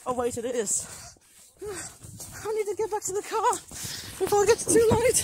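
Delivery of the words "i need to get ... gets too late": worried dash to the car before it gets too late; urgent panic. Real, not acted. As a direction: race the clock, voice up high. Hurrying back to the car.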